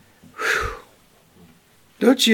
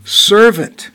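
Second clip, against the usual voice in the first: Servant said loud and slow, the word leaned on; emphatic; 3 semitones higher, 8 dB louder than usual. Real, not acted. To the congregation, Servant hammered home. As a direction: make Servant land hard. Preacher stresses servant over apostle.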